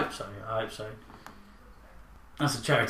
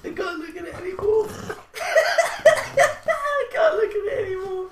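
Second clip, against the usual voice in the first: high squeal through helpless laughter; real uncontrollable laughing fit, voice climbing; laughing to tears; 5 dB louder than usual. Real, not acted. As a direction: wail the words while cracking up. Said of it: "wiping my face from tears".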